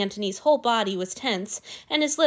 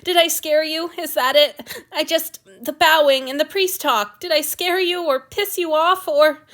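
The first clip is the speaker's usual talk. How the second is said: anxious questions pushed higher and louder; panicky; 7 semitones higher, 8 dB louder than usual. Acted. God fearing he has upset his servant, panicky and pleading. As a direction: rush the worry out, afraid he's done wrong. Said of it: "Now Antony sounded panicky".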